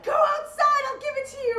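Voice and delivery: high-pitched mock yell; retold yell. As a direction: yell it up high without full volume. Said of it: "So I yelled at her".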